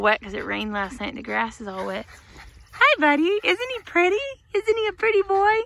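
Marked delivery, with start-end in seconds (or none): 2.7-5.6 high-pitched cooing to a dog; high doting doggy talk; pet talk. Real, not acted. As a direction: gush over the dog in a high, sing-song voice.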